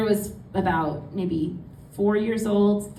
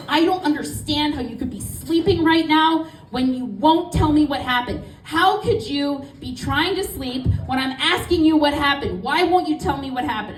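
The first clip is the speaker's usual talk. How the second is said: mom, higher and louder, demanding answers; upset shouting; 6 semitones higher, 4 dB louder than usual. Retold or imitated. Mom pounding on dad, demanding what happened, pitched-up angry shout. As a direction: hammer him with the question, pitched up and loud.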